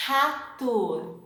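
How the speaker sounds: Rato with a throaty h-like R, like hatu; Portuguese R. Shown as a demonstration. Teacher modelling Rato for students to repeat. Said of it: "Another word".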